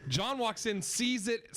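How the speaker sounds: ordinary talk, nothing marked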